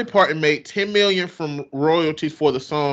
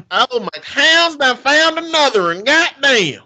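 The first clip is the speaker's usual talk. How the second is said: high, loud backwoods Southern drawl; hillbilly Southern accent; 9 semitones higher, 4 dB louder than usual. Acted. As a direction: holler it like a mock hillbilly. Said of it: "the voice that he's do".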